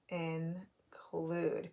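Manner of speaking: "Include" softly broken into In, pause, clude; syllable by syllable. Shown as a demonstration. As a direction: quietly separate both syllables of "Include". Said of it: "look at those syllables".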